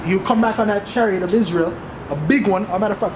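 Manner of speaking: ordinary delivery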